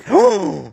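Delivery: high, loud "oh" of amazement; astonished cry. Real, not acted. Shooter reacts to seeing the tiny hit target, astonished cry. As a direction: let out a real high whoop of surprise.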